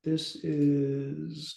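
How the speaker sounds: normal talking voice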